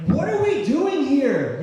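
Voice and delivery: high, loud, drawn-out wail; slow, high yell of frustration; inner scream. Retold or imitated. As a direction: scream it in desperate exasperation. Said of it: "I'm just screaming".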